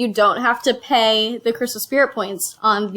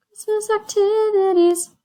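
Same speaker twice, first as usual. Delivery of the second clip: much higher sing-song "Christmas activities"; mock excited; 9 semitones higher than usual. Real, not acted. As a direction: lift "activities" way up, playfully. Repeating in mock delight.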